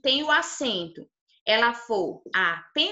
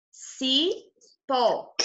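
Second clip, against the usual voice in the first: cipó split as ci... PÓ; teacher demonstrating the strong pó in cipó; stressed last syllable. Shown as a demonstration. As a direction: pause inside cipó and land on PÓ. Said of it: "O pó é a sílaba mais forte".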